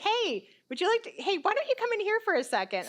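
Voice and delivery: high, light imitation of the radiologist; retold breezy invitation, pitched well up; casual calling over. Radiologist stops patient after her scan, casual high-pitched calling over.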